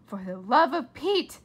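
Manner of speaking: loud exasperated cry; mother loses patience at the doorbell. A narrator's reading. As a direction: lose patience. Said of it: "said her mother".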